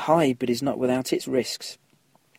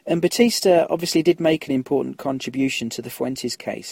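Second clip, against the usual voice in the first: ordinary talk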